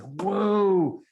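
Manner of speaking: long, rising "whoa"; amazed. Retold or imitated. Speaker recalls being stunned by the kid's reply, amazed. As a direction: draw it out in retold astonishment. Retold amazement at teen's remark.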